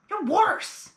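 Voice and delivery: normal talking voice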